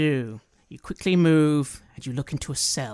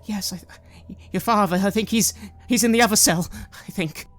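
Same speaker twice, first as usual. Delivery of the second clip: higher, hesitant mother's voice; mum; 6 semitones higher, 3 dB louder than usual. Acted. Mum to her child about father's cell, hesitant. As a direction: start faint, then lift it anxiously. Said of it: "you hear your mum say".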